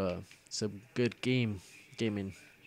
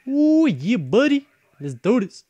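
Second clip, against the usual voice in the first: hyped shout; 10 semitones higher, 10 dB louder, slower than usual. Real, not acted. Hyped after a kill.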